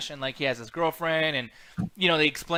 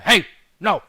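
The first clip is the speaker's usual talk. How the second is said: loud, higher-pitched barked refusal; mock barking; 5 semitones higher, 10 dB louder than usual. Husband refusing his wife's request, mock barking. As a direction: bark it like an attitude-y husband. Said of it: "just barking".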